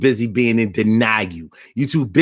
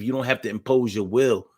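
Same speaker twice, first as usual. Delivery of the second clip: ordinary talk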